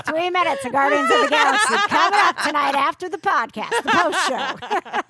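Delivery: laughing mock trailer announcer. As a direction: hype it like a TV promo.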